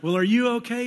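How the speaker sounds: high, loud, laughing concern; a kindly neighbor chuckling it off; amused neighbor. Retold or imitated. Neighbor hearing his car is in the creek, high and laughing. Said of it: "he's like".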